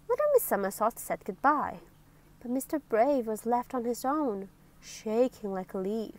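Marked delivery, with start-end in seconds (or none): none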